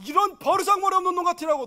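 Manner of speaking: loud, high angry scolding; shouted father's rebuke. Retold or imitated. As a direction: bark at the son. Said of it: "이래도 무방합니다".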